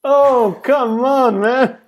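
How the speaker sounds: excited come on, man; excited plea. Real, not acted. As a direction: beg for it with real excitement. Excited plea about Halo movie.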